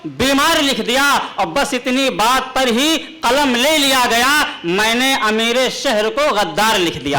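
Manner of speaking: measured, declaiming poetic delivery; ghazal recitation. Acted. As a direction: recite the couplet grandly.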